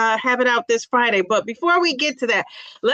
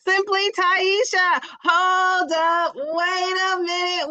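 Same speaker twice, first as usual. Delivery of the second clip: high, drawn-out sing-song welcome; excited greeting; 7 semitones higher, slower than usual. Real, not acted. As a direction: stretch the name out, thrilled to see her.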